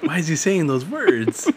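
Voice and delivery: high, slow, baffled; confused and high-pitched. Pretend. Confused cholos voiced.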